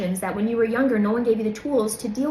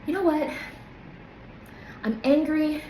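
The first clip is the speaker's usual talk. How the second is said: higher, frank admission of anger; honest anger; 6 semitones higher than usual. Shown as a demonstration. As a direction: let the anger show in a demo. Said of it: "be honest with yourself".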